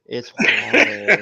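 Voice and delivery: slow and laughing through it's water. Real, not acted. Admitting the drink is water, laughing. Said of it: "laughing about a drink".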